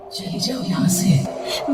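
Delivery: slow, eerie muffled whisper; hushed whisper. A narrator's reading. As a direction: whisper it slowly, as if into someone's ear. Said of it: "दबी हुई आवाज में धीरे से".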